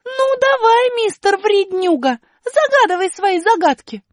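высоко и громко, дерзко и медленно; подначивай врага; вызов. Acted. Аля бросает вызов. (высокий дерзкий вызов Али)

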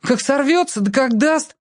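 put-on high scared whining kid; whiny little sibling. A narrator's reading. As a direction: fret about it in a high voice. Yashka keeps protesting, frightened.

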